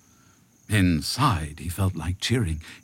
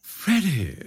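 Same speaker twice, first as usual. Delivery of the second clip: high, wheedling call; coaxing jackal; 10 semitones higher than usual. A narrator's reading. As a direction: call to him slyly, pitched up. Jackal coaxes the rhino.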